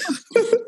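short high-pitched giggle. Real, not acted. Laughing after back to serious.

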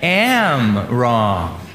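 very slow, each word spelled out together; slow group recital. Shown as a demonstration. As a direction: lead the room through it slowly. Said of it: "let's say in the present tense".

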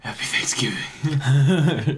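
mock-solemn giggly whisper; laughing whisper. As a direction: whisper it slowly through laughter. Echoes the hero whisper laughing.

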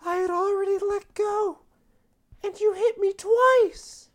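high whiny prisoner. Acted.

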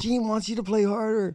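very high put-on messenger voice; relaying the boss. Stagehand repeating Gene's demand, very high voice. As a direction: repeat the order, pinched and high. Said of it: "He goes".

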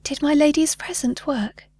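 high, lilting fairy voice; sing-song fairy. A narrator's reading. Fairy asks the girl about the present, sing-song voice. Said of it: "in her sing-song voice".

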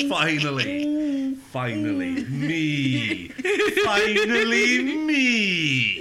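very drawn-out, swooping gloat; mock triumph. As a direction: stretch every word in hammed-up glory. Gloating over owning the podcast.

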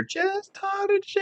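high, slow, drawn-out mock call of the kids' names; mom calling out. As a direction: call the kids home like mom from the porch.

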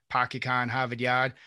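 Boston accent with the r's dropped. Shown as a demonstration. Podcast host showing guests New England's strong accents, Boston accent. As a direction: drop every r like a Bostonian. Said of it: "the strong accent two hours north is Boston".

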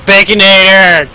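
slow, loud drawn-out call; drawn-out shout. Real, not acted. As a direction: stretch it out, loud. Shouted food name.

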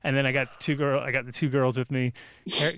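ordinary delivery

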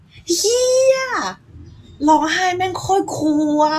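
high, loud burst of amazement; thrilled realisation mid-tears; amazed discovery. Retold or imitated. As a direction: blurt it out like a sudden revelation. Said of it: "แล้วก็พบว่า".

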